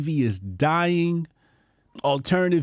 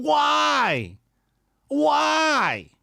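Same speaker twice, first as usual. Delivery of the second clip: exasperated; 10 semitones higher than usual. Real, not acted. Exasperated rant at leader.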